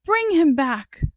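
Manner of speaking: high, loud plea-like order; urgent command. A narrator's reading. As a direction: call it out urgently. Sally orders a rescue.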